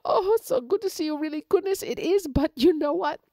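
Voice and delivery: high, loud nervous pleading; frightened pleading rabbit. A narrator's reading. Rabbit pleading with the wolf, high and scared. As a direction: beg for your life with a fake smile. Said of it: "And Conejito said".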